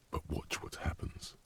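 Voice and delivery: soft unvoiced whisper; quiet warning whisper. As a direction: breathe it like a secret. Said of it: "He's whispering too".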